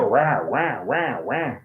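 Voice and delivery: exaggerated American R on each rah; American R sound. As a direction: imitate Americans with open-mouthed rah. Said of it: "they open up their mouths like".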